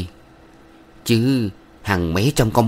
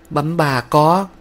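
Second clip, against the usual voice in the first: put-on higher, timid girl pleading; 8 semitones higher, slower than usual. A narrator's reading. Thanh pleading with the village head's wife, higher timid voice. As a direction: beg humbly, like a frightened young woman.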